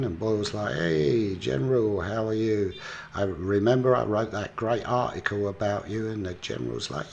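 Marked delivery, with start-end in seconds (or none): 0.7-6.1 loud, raised voice, imitating a keen flatterer; effusive greeting. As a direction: play up the glad-to-see-you act. Boyle greets the general back.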